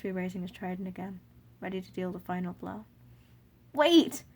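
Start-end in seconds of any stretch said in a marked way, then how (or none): 3.7-4.2 sudden high shout, much louder. Acted. Child to Asgore, desperate shout. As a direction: cry out to stop the blow. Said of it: "you shout".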